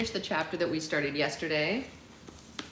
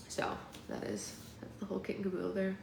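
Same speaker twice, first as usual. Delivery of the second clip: whispering; 10 dB quieter than usual. Real, not acted. Whispered aside while working.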